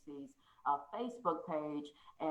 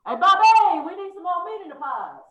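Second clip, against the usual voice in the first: bossy pie-shop owner; 13 semitones higher, 15 dB louder than usual. Pretend. Voicing Sweeney Todd scene.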